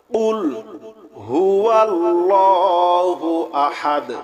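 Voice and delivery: slow, melodic tajweed chant; slow Quranic recitation. Shown as a demonstration. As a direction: draw out each word in recitation.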